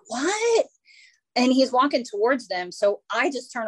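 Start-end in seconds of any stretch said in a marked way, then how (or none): 0.1-0.7 surprised what. Retold or imitated. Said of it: "He's like".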